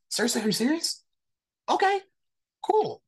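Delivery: very high, incredulous squeak; high-voiced, bemused acceptance; retold disbelief. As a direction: go way up in pitch, baffled. Acting out disbelief, then giving in.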